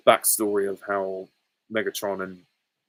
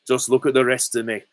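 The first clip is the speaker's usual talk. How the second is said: higher Northern English creature voice; Absorbaloff; 4 semitones higher, 6 dB louder than usual. Acted. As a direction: do a boastful monster with a Northern accent. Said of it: "when he becomes the Absorbaloff, he says".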